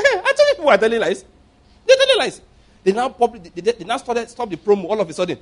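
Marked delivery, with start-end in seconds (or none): 0.0-1.2 laughing retort. Retold or imitated. Mocking retold exclamation with laugh. 1.9-2.4 They're telling lies, shouted high. Real, not acted. Preacher to congregation, a high shout.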